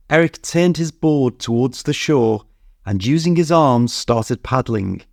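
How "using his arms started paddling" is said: plain reading voice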